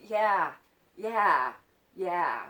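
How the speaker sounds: angry retold shout, each word separate; loud, hammered insistence; pissed off. Child being played with answers back, pissed off. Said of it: "it's pissing her off".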